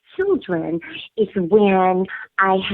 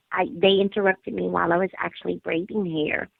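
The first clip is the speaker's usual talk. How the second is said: ordinary delivery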